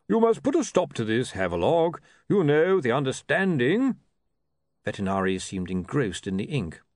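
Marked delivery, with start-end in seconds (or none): 0.1-4.0 loud, bluff complaining voice; a hearty cleric grumbling; blustering priest. Acted. Ridcully demands.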